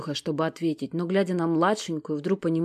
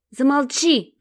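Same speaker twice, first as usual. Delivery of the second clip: plain reading voice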